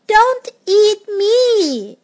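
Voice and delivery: slow, high plea from a puppy; crying out. A narrator's reading. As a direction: draw out a high begging cry. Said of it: "cried the puppy".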